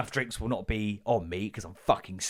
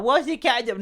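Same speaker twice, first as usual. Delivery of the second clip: put-on much higher, louder child voice; little girl; 9 dB louder than usual. Libertine asking dad about the cat, high child voice. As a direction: ask like a worried little girl. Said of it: "Libertine's like".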